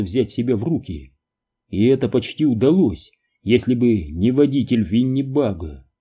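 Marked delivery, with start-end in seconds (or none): none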